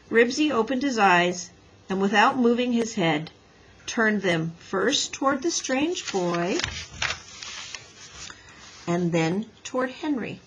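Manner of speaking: ordinary delivery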